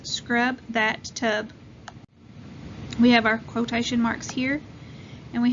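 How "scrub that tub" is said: slow reading aloud. Shown as a demonstration. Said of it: "Now let's read this sentence".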